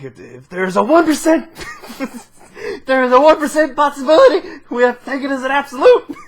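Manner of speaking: high, loud movie line breaking into laughter; hammed-up intensity, cracking up as you go; mock-intense quote, laughing. Friend quoting Batman's one percent line, hammed up and laughing.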